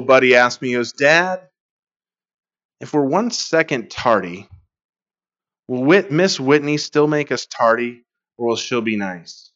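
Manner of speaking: ordinary delivery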